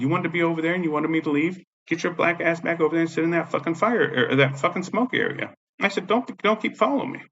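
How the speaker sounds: high-pitched, exasperated telling-off; retold angry warning. Retelling his angry order to her.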